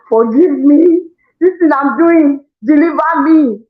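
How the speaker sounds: high, loud, drawn-out mock plea; pleading prayer. Remorseful mother praying to God after yelling, pleading. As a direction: beg for mercy, slowly and dramatically. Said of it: "I'll go back to my mind and say, Father, have mercy on me".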